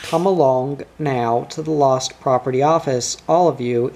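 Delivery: ordinary delivery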